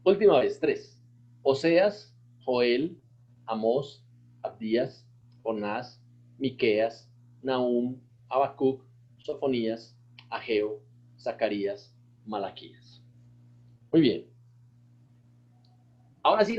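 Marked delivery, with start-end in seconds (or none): 1.4-13.0 each name set apart with a pause; one name at a time, carefully; slow recitation. Shown as a demonstration. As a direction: pastor slows the list so the class can follow. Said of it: "mientras más despacio lo hagan".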